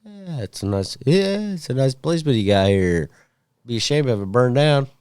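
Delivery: mock foreign voice. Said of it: "What about when they say".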